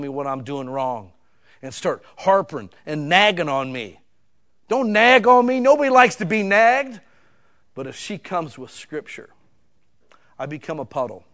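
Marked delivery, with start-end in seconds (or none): 4.7-7.0 emphatic protest. Acted. Preacher mimics being nagged.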